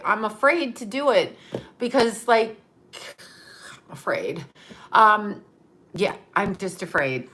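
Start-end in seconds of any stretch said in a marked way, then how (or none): 2.9-4.4 hushed mock-scared whisper. Confessing to viewers she is scared, in a whisper. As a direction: breathe it like a secret. Confessing fear of fixing haircut.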